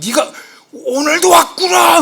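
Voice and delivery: high, drawn-out overjoyed cry; mock amazement. Preacher acting someone stunned to see a friend came today, exaggerated amazement. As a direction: greet them with hammed-up astonishment. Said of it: "볼 때마다 막 놀래요".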